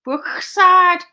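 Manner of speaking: loud, high mock Scouse with throaty k; Liverpool accent. Shown as a demonstration. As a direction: rasp the k in a hammed-up Liverpool accent. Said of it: "like, a Liverpool accent".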